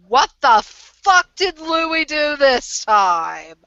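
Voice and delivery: loud, slow, high outraged yell; angry shout. Acted. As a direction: bellow it at the whole room. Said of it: "and go".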